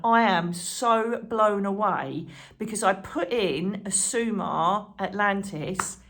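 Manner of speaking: usual speaking voice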